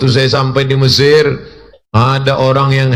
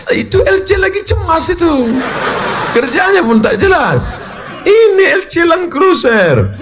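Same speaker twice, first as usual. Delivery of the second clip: laughing; 13 semitones higher than usual. Retold or imitated. Mocking the in-laws' bragging.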